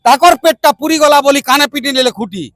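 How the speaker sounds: measured, rhythmic verse; protest poem recitation. Acted. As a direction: recite with a steady beat, rallying your people. (ଛନ୍ଦରେ କବିତା ଆବୃତ୍ତି)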